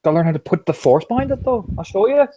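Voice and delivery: high, loud, cocky fighter; gruff brawler voice. Acted. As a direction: talk like a cocky pit fighter.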